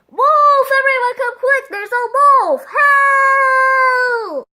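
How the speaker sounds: high, loud desperate shouts for help; panicked yelling. A narrator's reading. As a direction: scream for the village to come. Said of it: "the boy's cries".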